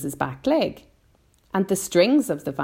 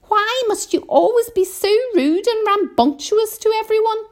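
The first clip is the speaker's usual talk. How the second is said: high, loud, outraged scolding; indignant earthworm; 7 dB louder than usual. A narrator's reading. Earthworm demands an apology for James, high indignant scolding. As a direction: scold at the top of your pitch. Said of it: "cried the earthworm".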